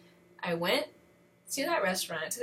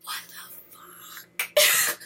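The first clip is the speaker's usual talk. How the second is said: drawn-out, voiceless exclamation; stunned disbelief; 5 dB louder, much slower than usual. Acted. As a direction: mouth it slowly with loud breath, utterly baffled. Stunned reaction to hang-up.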